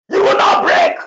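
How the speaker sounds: shouted promise, high and loud; forceful shout. Real, not acted. Prophet shouting a promise to the congregation, forceful shout. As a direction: cry it out over the people.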